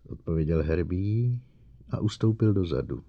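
ordinary delivery, nothing marked

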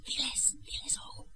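hushed, secretive prompting; urgent whisper. Acted. Aunt secretly calling the hidden boy out, urgent whisper. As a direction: whisper it so only one person hears.

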